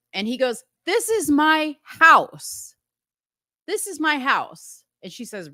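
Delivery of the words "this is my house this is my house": high, slow, emphatic claim; acted-out husband standing his ground; angry insistence. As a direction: stake the claim word by word, pitched up. Acted quarrel over who owns house.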